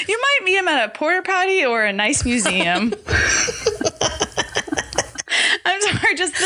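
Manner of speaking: laughing through the words. Real, not acted. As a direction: try to get it out while cracking up. Cracking up over the quote.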